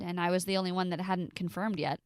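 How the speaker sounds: usual speaking voice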